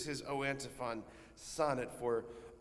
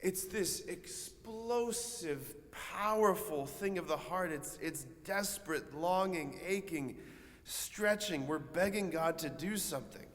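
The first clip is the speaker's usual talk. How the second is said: raised, stretched-out preacher's voice; fervent preaching; 4 semitones higher than usual. Real, not acted. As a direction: draw out each word with aching intensity. Preaches on desperate longing.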